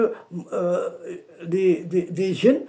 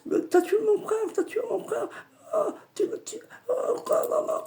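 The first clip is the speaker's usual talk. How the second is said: high wailing lament, much higher pitch; wailing grief; 4 dB quieter, faster than usual. Retold or imitated. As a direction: wail it like a grieving spirit. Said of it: "Il a commencé à pleurer". (le djinn pleure son frère : lamentation aiguë et gémissante)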